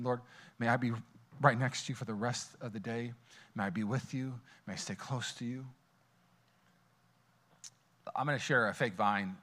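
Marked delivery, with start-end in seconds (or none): 0.0-5.7 quiet prayer. Retold or imitated. Quoting his quiet prayer.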